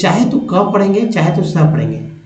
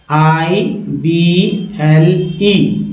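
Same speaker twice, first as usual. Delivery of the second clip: spelled very slowly; much slower than usual. Shown as a demonstration. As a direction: spell आईबीएलई letter by letter, very slow. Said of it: "इसे एबल बोलते हैं".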